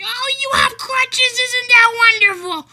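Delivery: very high, loud sing-song praise; mocking patronizing stranger. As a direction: coo at a man on crutches. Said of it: "do you go up to him and say".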